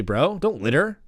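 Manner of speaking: usual speaking voice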